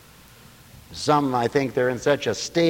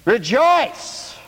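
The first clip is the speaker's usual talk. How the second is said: high, ringing "Rejoice"; exclaimed "rejoice"; 9 semitones higher, 4 dB louder than usual. Shown as a demonstration. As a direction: proclaim "Rejoice" like a preacher's command. Second, emphatic take of command.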